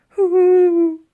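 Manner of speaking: high, loud whimpering mammoth hoots. A narrator's reading. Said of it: "she whimpered".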